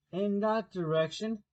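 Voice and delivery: lightly voiced sly grinning cat, amused; a hint of a grinning cat, unhurried; Cheshire cat voice. Cat points the way.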